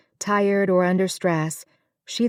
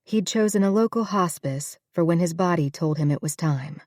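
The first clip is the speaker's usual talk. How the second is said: ordinary delivery, nothing marked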